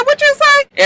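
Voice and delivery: very high-pitched challenge; mock confrontational. Marcus turns around on Fitz, high mock challenge. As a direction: shoot up high on the dare.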